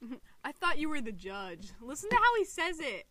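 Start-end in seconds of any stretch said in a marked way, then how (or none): 0.4-1.7 lower-pitched imitation of a robot character; dropped voice. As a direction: drop your voice low, imitating him. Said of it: "His voice dropped, like, two octaves".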